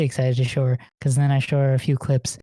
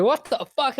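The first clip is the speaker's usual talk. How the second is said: high, incredulous "what the fuck"; mock disbelief; 8 semitones higher, 3 dB quieter than usual. Girlfriend hearing about Digivices, mock baffled. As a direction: jump up in mock confusion.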